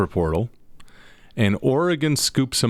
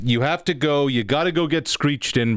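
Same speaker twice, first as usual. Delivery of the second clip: a high, lightly put-on Newfoundland accent; a hammed-up bad Newfoundland accent, pitched up; terrible Newfoundland accent; 5 semitones higher than usual. Co-host tells host about getting screeched in, in a bad Newfoundland accent. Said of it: "That was a terrible Newfoundland accent".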